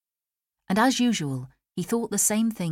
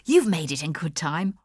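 bright, raised welcome with a grin; grinning exclamation; 5 dB louder than usual. A narrator's reading. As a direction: greet the guests with a grin. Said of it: "he grinned and exclaimed".

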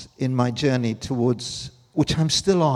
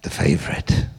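whispered aside; 12 semitones lower, 3 dB louder than usual. Real, not acted. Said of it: "fond aside about daughter".

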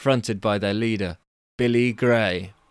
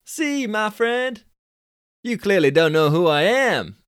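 high, loud Californian dude scoff; 10 semitones higher, 5 dB louder than usual. Acted. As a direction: squeak up the pitch like a cocky Californian. Billy voiced.

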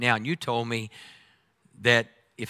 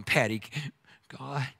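much softer, pleading mumble; mock desperate plea; 12 dB quieter than usual. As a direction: beg God in a small defeated voice. Desperate whiny prayer after bad purchase.

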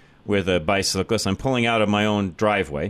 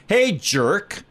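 raised, heated retort; angry yell at cyclist; 5 semitones higher than usual. Retold or imitated. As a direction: snap back at him from the car window. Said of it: "I'm like".

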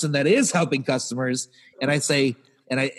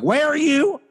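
high, rising incredulous question; mock demanding question; 12 semitones higher, 4 dB louder than usual. Customer service asking a caller's location, mock demanding. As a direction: imitate an impatient clerk on the line.

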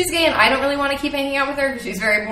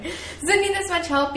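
pitched-up incredulous question; high mock question; 9 semitones higher, 4 dB quieter than usual. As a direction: squeak it out in disbelief. Repeats the mocking question.

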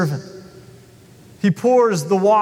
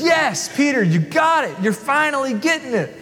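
high, teasing praise; pleased Jesus; 5 semitones higher than usual. Acted. Jesus praising Peter for finally getting it, teasing. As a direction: tease him warmly. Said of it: "Jesus says".

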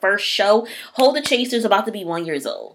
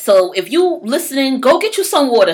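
louder and higher, urging everyone; 7 semitones higher, 8 dB louder than usual. Real, not acted. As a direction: call out to listeners, higher and louder. Urging listeners to drink water.

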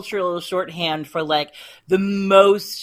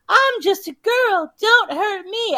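much higher, slower, put-on helpless voice; mock helpless girl; 13 semitones higher, 4 dB louder, slower than usual. Kate faking helplessness to fool a captor, high mock voice. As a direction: play the harmless damsel to buy time. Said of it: "pretend to be more helpless than you are".

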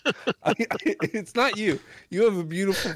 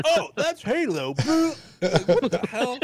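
high, drawn-out disbelief with a laugh; mock outrage at the obvious answer; mock exasperated realization; 8 semitones higher, slower than usual. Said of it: "And I'm like".

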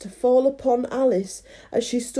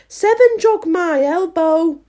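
raised, high-pitched sulky blame; 8 semitones higher, 5 dB louder than usual. A narrator's reading. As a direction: act a sulk, shifting the blame. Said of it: "said Five in a sulky tone".

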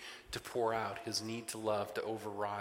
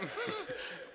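high burst of laughter; 5 dB quieter than usual. Real, not acted. Laughing at his own story.

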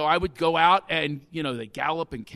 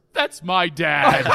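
proud and laughing; 8 dB louder, much slower than usual. Real, not acted. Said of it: "I just felt so proud".

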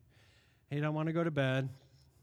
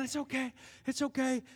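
soothing; 7 semitones higher than usual. Retold or imitated. Soothing baby again.

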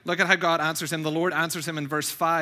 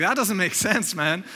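mildly raised comic objection; mock incredulous; 4 semitones higher, 3 dB louder, slower than usual. Host to contestant over a silly answer, mock incredulous. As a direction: lightly act the baffled host.

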